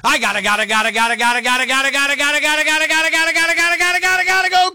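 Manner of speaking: stuttered gottas rising steadily in pitch; frantic build-up. Acted. Rant ends in frantic stutter.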